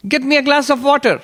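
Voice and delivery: put-on very high, crisp old monk's command; high-pitched voice. Old monk testing novices, high-pitched command. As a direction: order it up high like the old teacher. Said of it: "He had a high-pitched voice".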